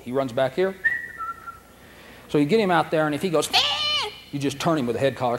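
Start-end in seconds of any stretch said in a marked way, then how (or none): 3.5-4.1 very high startled squeak; dog's yelp. Pretend. Nervous dog spotting another dog, high yelp.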